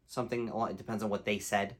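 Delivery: ordinary delivery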